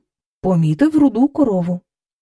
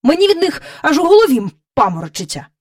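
quick, higher-pitched irritated grumble; 6 semitones higher, 4 dB louder, faster than usual. A narrator's reading. King dizzy from the cow's capers, irritated. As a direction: complain testily, at speed. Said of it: "роздратовано додав він".

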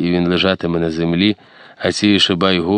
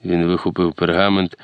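ordinary delivery

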